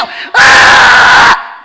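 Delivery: long, loud mock labour scream; birthing scream. Mother giving birth, long loud acted scream. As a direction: scream as if pushing hard. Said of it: "그래, 엄마가".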